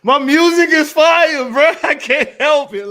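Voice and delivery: high, loud and hyped, laughing; gushing over his own music; hyped laughing excitement. Real, not acted. Streamer hyped about his soundtrack, loud and laughing.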